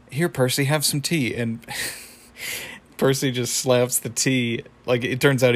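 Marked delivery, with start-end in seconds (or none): 0.1-1.4 lightly voiced polite tea host; Pops. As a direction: offer it innocently in your own slightly lifted voice. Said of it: "Pops is like".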